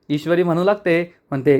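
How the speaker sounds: ordinary delivery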